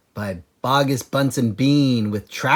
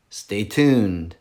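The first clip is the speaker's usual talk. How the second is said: ordinary delivery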